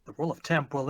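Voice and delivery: ordinary delivery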